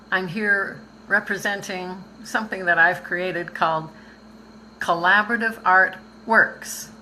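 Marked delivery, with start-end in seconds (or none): 4.8-6.9 "Works" leaned on, a bit louder; name the project and stress "Works"; emphasis on works. Shown as a demonstration. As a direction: give "Works" the weight. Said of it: "I like to put the emphasis on the word works".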